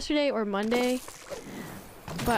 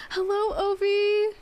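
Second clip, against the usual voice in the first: excited greeting; 9 semitones higher, slower than usual. Real, not acted. Greeting a friend arriving.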